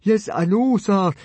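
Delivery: flustered, high-pitched nervous reply; cowed and flustered; stammering. A narrator's reading. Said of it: "stammered Fishlegs".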